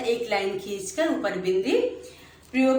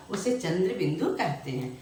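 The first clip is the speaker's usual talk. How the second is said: ordinary talk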